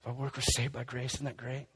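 lightly playing a friend shrugging off sin; mock breezy reassurance. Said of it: "we start preaching the gospel".

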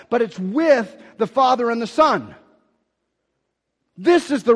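loud, high, driving preacher voice; preaching at full force. Acted. Pastor preaching hard to the church, loud and high. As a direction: preach it out to the congregation.

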